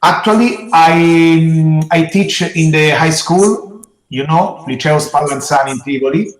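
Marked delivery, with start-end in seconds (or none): none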